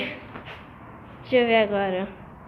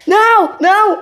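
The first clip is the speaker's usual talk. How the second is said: alarmed shout; 5 semitones higher, 4 dB louder, slower than usual. Pretend. Sudden scare.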